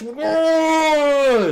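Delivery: drawn-out high "whoa" for pouring; imitated gushing spill; long high whoosh. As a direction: mime dumping a bottle with a sustained high noise. Imitates unscrewing and dumping the bottle.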